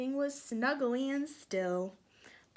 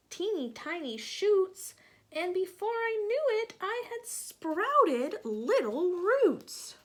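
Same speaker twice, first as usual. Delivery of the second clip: high, louder, slowing at the end; delighted; 9 semitones higher, 6 dB louder than usual. Acted. Acorn thrilled at sprouting roots. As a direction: swell with glee.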